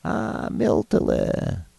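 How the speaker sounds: put-on slow, high ghostly old grandfather; thin, quavering voice. A narrator's reading. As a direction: quaver thinly as a spirit from beyond. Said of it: "a thin voice quavered".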